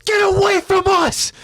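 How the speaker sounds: raspy, very high-pitched scream; desperate screaming warning-off. A narrator's reading. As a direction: scream it at full force, voice rasping. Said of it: "Eren stops to scream out".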